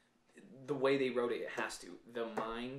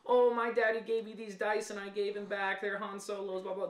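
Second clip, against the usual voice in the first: mock whiny Kylo; 8 semitones higher, 5 dB louder than usual. Imagined Kylo backstory line.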